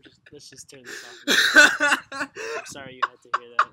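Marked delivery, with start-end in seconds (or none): 1.0-2.1 loud, high helpless laughter through the words; real cracking up; laughing hard. Real, not acted. Cracking up after the joke. 2.1-3.7 loud, laughing through the words; real laughter in the voice; laughing apology. Real, not acted. Still laughing, apologises for the joke.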